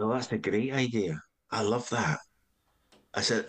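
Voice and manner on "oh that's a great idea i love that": lightly imitated Scottish accent. Comedy head taking to the pitched idea, imitated Scottish accent. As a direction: approve it in mock Scots. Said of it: "I was Scottish, by the way".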